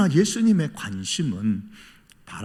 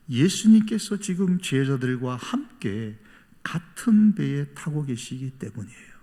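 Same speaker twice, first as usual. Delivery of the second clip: ordinary talk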